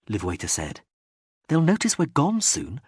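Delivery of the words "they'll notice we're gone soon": plain reading voice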